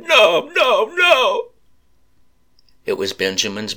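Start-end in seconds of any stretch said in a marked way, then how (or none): 0.0-1.5 high, loud anguished wail; grief-stricken cries of no; crying out. A narrator's reading. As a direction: cry out in grief at the body. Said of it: "she cried".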